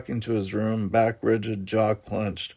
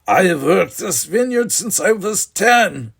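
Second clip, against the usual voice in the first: much higher, louder shrill outburst; shrill anger; 9 semitones higher, 8 dB louder than usual. Acted. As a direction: throw years of work in his face. Brother's angry outburst.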